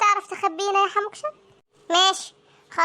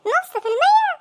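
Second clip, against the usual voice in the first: high, louder, disbelieving "نص في المية؟" with a rising end; shocked disbelief; 9 semitones higher, 4 dB louder than usual. Acted. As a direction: gasp out the question in alarm. Shocked friend hears half percent. (استغراب مصدوم بصوت عالي)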